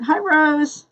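usual speaking voice

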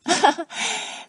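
high-pitched happy chuckle; delighted giggle; gleeful laugh. Acted. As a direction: laugh at your own good fortune.

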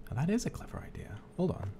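soft unvoiced whisper. Real, not acted. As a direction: whisper it, sneaking. Whispering in game.

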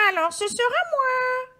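high-pitched squawking hen; little hen voice. A narrator's reading. Hen deciding to do it herself, high hen voice. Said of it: "dit la petite poule rousse".

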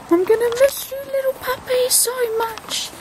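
high sing-song voice to a dog. Real, not acted. Talking to puppy before leaving.

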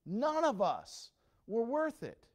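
loud and slow, every word weighed; slow, loud preaching. Acted. Preacher driving the point home to the church, slow and loud. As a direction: lean on it slowly and loudly.

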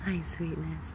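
ordinary delivery

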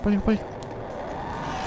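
천천히 속삭이며 재촉; 들키지 않게 숨죽여 말하기; 속삭임. Real, not acted. 숨어서 몰래 재촉. (속삭이며 재촉)

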